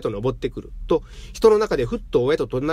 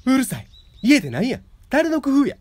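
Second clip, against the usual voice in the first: put-on high, loud Kyoto-accent shouting; furious Kyoto accent; 11 semitones higher, 4 dB louder than usual. A narrator's reading. Wife screams back at husband, furious Kyoto accent. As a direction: explode at him in Kyoto accent. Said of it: "京都のアクセントに戻っていた".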